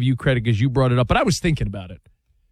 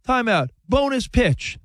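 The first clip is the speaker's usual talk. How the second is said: higher, slow announcing call; measured, raised-pitch ruling from behind the plate; umpire call; 7 semitones higher than usual. Acted. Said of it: "The umpire says".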